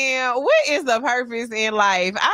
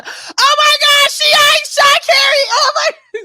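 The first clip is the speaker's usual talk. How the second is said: high squealing shout of shock; starstruck scream; 9 dB louder than usual. Real, not acted. Host spots Shy Kiera in chat, shrieks starstruck. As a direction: she screams as the star appears online.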